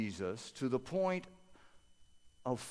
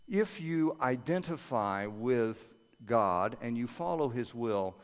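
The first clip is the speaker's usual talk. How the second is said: usual speaking voice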